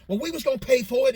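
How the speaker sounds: high, loud and fast defiant retort; imitating an angry mama standing her ground; mother telling off. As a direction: fire it back at the man who grabbed her son. Mother arguing with store man.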